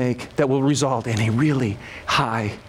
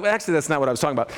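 God's quick correction; 2 semitones lower, much faster than usual. Retold or imitated. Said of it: "but God's like".

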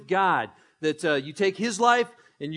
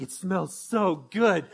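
drawn out slowly, as if breathing in the smell; slow savoring; much slower than usual. Retold or imitated. Addie sniffing the marshmallow, slow and dreamy. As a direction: linger over each word like the sweet is right under your nose. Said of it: "she's like".

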